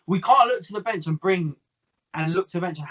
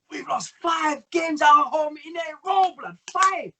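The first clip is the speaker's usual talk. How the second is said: mock shout; 11 semitones higher than usual. Host imitating DT's angry voice note, shouting. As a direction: shout it like a furious fan. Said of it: "D T was going".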